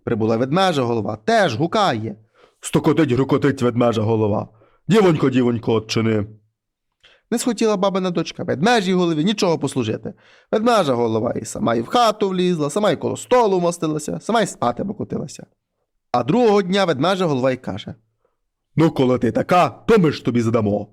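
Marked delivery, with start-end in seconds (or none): 2.6-6.3 low, heavy voice of a wolf head at the door; low monster head voice. Acted. Wolf head knocks and calls the girl, low and heavy. 18.8-20.9 low, heavy threat from a beast head; low menacing head voice. Acted. Bear head threatens the rude daughter, low and heavy.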